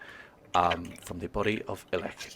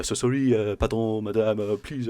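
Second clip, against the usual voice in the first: put-on Thippian accent; 3 semitones higher than usual. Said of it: "over-exaggerated Thippian accent".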